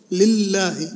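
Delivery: لله with the L held double; doubled lam. Shown as a demonstration. Teacher pointing out the first shadda, held L in لله. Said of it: "هذه أول شدة".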